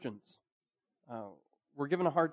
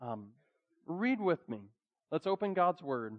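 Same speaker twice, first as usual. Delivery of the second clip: normal talking voice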